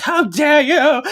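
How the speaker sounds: high, drawn-out hammed-up indignation; mock outrage. Mocking the villain as spirits swarm Piper, hammed-up outrage. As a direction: wail it slowly like an affronted villain.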